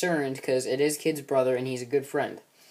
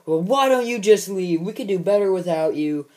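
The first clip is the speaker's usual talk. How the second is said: loud, much higher angry shout; furious outburst; 6 semitones higher, 8 dB louder than usual. Retold or imitated. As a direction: blow up at him, pushed over the edge. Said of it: "sets Nine over the edge".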